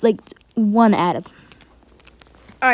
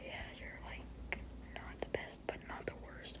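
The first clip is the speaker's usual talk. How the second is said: hushed whisper to the character; 29 dB quieter than usual. Acted. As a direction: breathe it out, barely voiced.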